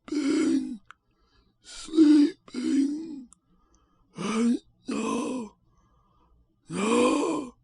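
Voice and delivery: very high, slow, drawn-out voice of a dead man; unearthly hollow voice. A narrator's reading. Mesmerised dead Valdemar answers the narrator's question, unearthly hollow voice. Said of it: "the sound was harsh and broken and hollow".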